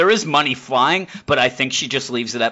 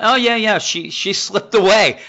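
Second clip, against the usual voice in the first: high, louder nervous stammer, slowing; sheepish cops; 8 semitones higher, 4 dB louder than usual. Retold or imitated. Cops squirming before the boss, high and halting. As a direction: fumble an awkward excuse. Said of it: "And they're like".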